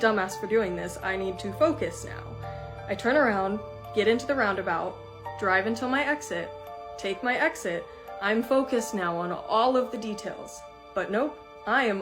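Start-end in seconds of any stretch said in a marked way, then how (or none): none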